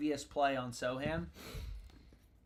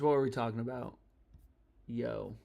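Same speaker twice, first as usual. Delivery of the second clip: ordinary delivery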